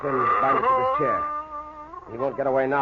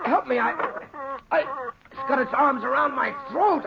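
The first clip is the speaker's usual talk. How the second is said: high, strangled cries for help; terrified gasping plea; choking panic; 10 semitones higher than usual. Acted. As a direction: plead as if hands grip your throat. Ned attacked by invisible thing.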